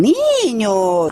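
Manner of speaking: ordinary talk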